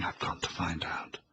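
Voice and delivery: breathless secret whisper to herself; hushed whisper from the shadows; whispered thought. A narrator's reading. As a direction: hide and whisper it. Inner thought in hiding.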